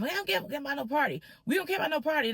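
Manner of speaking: high, rapid dismissive retort, imitated; upset mother. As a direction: imitate a mother brushing off the plea. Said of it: "They was upset".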